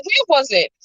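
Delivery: normal talking voice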